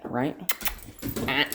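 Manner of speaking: usual speaking voice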